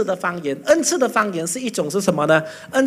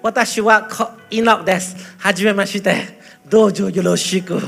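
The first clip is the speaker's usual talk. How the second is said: playful stock Japanese phrases; lightly put-on Japanese speech; mock Japanese. Shown as a demonstration. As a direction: show off a sudden Japanese greeting. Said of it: "我在讲这，日语哈".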